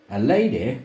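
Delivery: slow, high, languid Southern lady; languid drawl. A narrator's reading. Narrator voicing Serena to Luther, languid drawl. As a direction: drawl it out like a reclining grande dame. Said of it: "she would say in her most languid, multisyllabic drawl".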